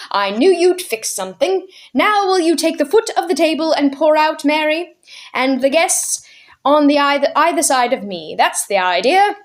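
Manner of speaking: put-on jolly old gentleman host. Mister Wig hosting his birthday tea, merry and proud. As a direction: beam at the guests while pointing to their seats.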